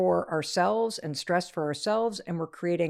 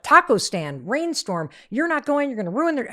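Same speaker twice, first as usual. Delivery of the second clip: rising, louder frantic rant; losing it; 6 semitones higher, 6 dB louder than usual. Retold or imitated. Mom losing it over taco stand plans, frantic and rising. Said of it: "I start to just lose it".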